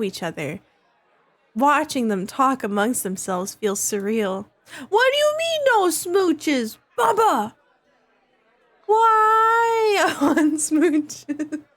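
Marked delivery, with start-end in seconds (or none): 4.9-11.6 high indignant whine; mock indignant. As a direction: whine it, hurt.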